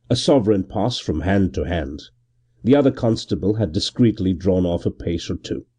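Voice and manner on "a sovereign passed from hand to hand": ordinary delivery, nothing marked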